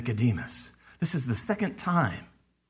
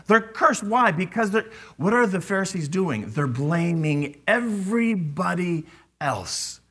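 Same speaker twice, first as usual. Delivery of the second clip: ordinary delivery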